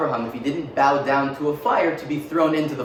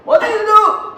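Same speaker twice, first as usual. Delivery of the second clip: much higher, much louder slow shriek in a put-on Mesopotamian accent; Mesopotamian accent scream; 12 dB louder, slower than usual. Father finding the smashed idols, screaming in a Mesopotamian accent. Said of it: "he screamed in his Mesopotamian accent".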